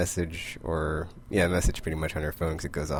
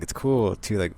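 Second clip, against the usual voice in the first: ordinary delivery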